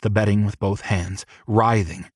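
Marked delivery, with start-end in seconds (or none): none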